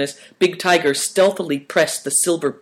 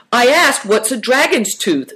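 higher-pitched apologetic explanation; 9 semitones higher, 4 dB louder than usual. A narrator's reading.

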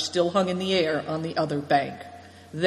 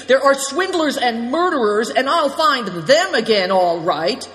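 louder, higher burst of anger; sudden shout; 7 semitones higher, 6 dB louder than usual. A narrator's reading. Good Fortune raging about the car thieves, loud shout. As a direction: erupt at the thieves. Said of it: "he shouted suddenly".

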